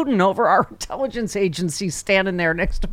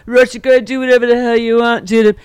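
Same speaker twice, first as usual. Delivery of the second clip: leering mock Trump voice, louder; sneering Trump impression; 3 semitones higher, 6 dB louder than usual. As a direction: play Trump as a leering creep. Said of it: "Rude Pundit said how rapey".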